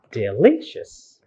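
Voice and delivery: "delicious" slowly with a long stressed LI; correct second-syllable stress. Shown as a demonstration. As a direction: lean on the middle of "delicious".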